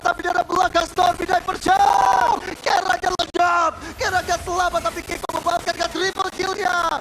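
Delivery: shouted hype play-by-play; fighting game announcer. Real, not acted. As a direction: yell the action as it happens. Caster hype during team fight.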